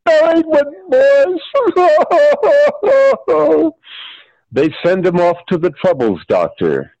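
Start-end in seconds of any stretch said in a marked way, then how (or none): 0.0-3.8 piercing, hammed-up high cry; high-pitched outburst. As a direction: wail it way up high. Opening acted outburst, very high pitch.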